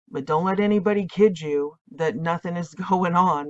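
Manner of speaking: ordinary talk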